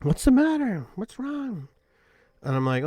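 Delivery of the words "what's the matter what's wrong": much higher, a gentle, concerned nun; nun checking on a struggling hiker, high and concerned; kindly nun. Retold or imitated. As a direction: ask like a caring old nun, voice pitched way up. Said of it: "the one nun goes to me".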